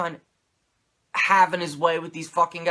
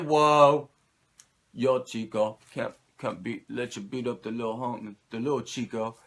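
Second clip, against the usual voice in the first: bad Razor impression; 4 semitones lower than usual. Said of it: "I can't do a fucking Razor impression".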